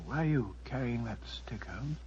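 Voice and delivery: soft whispered question. Acted. Watson whispering to Holmes in the dark, soft whisper. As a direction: whisper so the killer can't hear.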